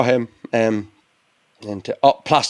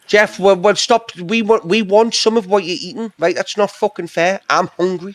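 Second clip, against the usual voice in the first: whiny pleading; 6 semitones higher than usual. Real, not acted. Begging for some of Jeff's food.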